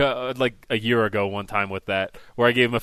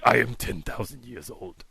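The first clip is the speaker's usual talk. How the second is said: put-on character; 6 dB quieter, slower than usual. Prankster giving his age to caller, slow character voice. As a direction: intone it slowly like an immortal. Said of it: "Sasha Baron Cohen taking these calls".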